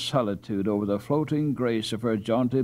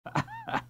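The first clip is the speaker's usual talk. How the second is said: chuckle; 9 dB quieter than usual. A narrator's reading. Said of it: "Laughed Jan Zoon to himself".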